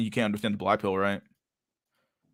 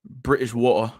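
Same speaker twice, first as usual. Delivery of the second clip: badly faked British accent; slower than usual. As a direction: attempt a British accent, jokingly. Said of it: "I can't speak British".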